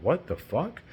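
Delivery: as if you can't believe it; incredulous what the fuck. Retold or imitated. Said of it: "you're like".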